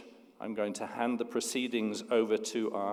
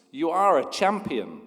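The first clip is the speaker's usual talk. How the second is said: high, loud echo; the mountain echoing the praise; echo answer; 6 semitones higher, 6 dB louder than usual. Acted. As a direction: throw it back like an echo. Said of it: "The voice answers".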